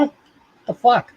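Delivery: ordinary talk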